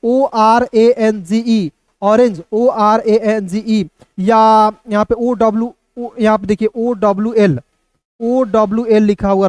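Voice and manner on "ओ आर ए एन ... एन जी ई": put-on slow, sing-song spelling like a childhood chant; recalling the school chant, spelled slowly; slow rote spelling. Shown as a demonstration. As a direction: chant orange letter by letter. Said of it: "बचपन से दोस्तों रटते आ रहे हैं".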